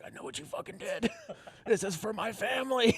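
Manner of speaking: hushed, high, menacing whisper; mock psychopath. Psychopath taking revenge on a raccoon, hammed-up hushed menace. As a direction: act the vengeful psycho under your breath. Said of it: "like a fucking psychopath".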